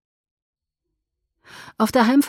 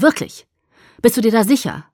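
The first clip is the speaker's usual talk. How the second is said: louder, higher agitated challenge; 4 semitones higher, 7 dB louder than usual. Acted. Edward challenging Alice, agitated and loud. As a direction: throw the doubt at her. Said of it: "hob aufgebracht die Hände".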